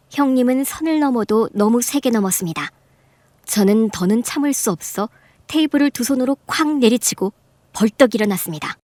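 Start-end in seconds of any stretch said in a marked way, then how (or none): none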